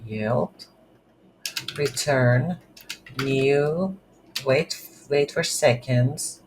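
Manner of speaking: ordinary talk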